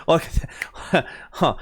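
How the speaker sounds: breathy laughing through the words. Real, not acted. Laughing at herself after blurting out Toyen. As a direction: giggle at her own slip. Laughing at her own slip.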